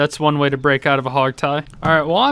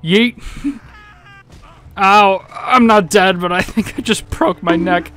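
usual speaking voice

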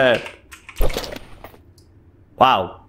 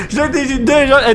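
normal talking voice

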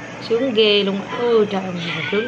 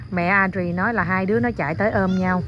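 ordinary talk, nothing marked

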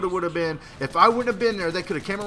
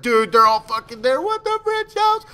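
mock panic; 10 semitones higher, 6 dB louder than usual. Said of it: "I was like".

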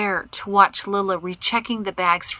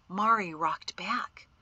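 ordinary delivery

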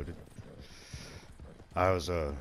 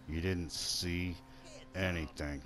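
drawn out with a pause before "anything"; softer, slower, spelling it out; slow, deliberate; 6 dB quieter than usual. Pretend. Player to a witness of his crime, slow and deliberate. Repeat, slowed for mock menace.

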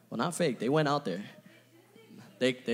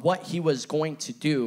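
ordinary talk

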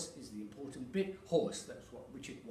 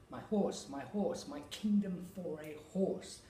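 horse and kingdom lifted a little higher; natural emphasis; 5 semitones higher than usual. Shown as a demonstration. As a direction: stress the important word, still real. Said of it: "It's an emphasis on the important word".

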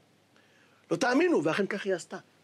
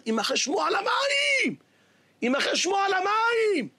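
high, slow shouted proclamation; preaching shout; 11 semitones higher, slower than usual. Real, not acted. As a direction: proclaim it to the whole hall. Preacher's climax.

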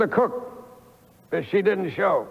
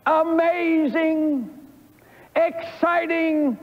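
preaching build; 8 semitones higher, slower than usual. Acted.

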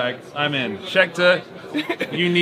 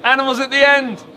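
higher and louder, called out to the viewers; loud sign-off; 8 semitones higher, 6 dB louder than usual. Real, not acted. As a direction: shout the sign-off to the audience. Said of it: "Yeah, say it to them".

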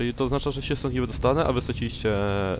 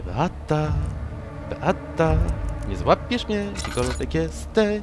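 teasing sing-song taunt; 5 semitones higher, slower than usual. Pretend. Taunting Beata.

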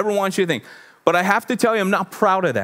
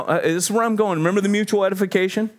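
ordinary talk, nothing marked

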